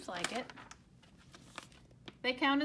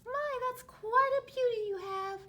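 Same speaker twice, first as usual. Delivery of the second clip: much higher little bird voice calling across; blue bird calling; 12 semitones higher, 4 dB louder than usual. A narrator's reading. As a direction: call it out bright and high like a small bird. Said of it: "called a blue bird".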